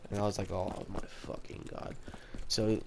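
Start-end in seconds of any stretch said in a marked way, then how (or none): 0.5-2.0 quiet, low-energy retold dread under the breath; hushed mock dismay. Student hearing his parents will be called, hushed dismay. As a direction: drop to a near-whisper, reliving the dismay.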